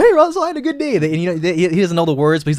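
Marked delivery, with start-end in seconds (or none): 0.0-1.0 high happy sing-song; high cheery voice to the dog; cheerful owner. Retold or imitated. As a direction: sound thrilled to your dog.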